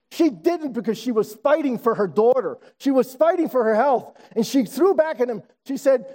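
ordinary talk, nothing marked

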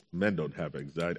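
low, macho pronouncement. Acted. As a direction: drop the voice to sound manly. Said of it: "my voice got deeper".

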